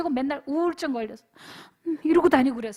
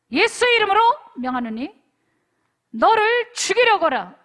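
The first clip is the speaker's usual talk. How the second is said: loud, higher authoritative command; commanding exorcism; 5 semitones higher, 8 dB louder than usual. Retold or imitated. Pastor retelling how he cast the demon out of the boy, commanding. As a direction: order the spirit out in Jesus' name. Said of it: "라고 얘기했어".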